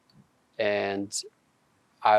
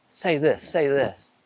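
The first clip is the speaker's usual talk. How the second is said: higher-pitched mock pestering; imitated eager urging; 5 semitones higher than usual. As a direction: mimic excited friends pressing for a performance. Imitates sister's friends egging him on.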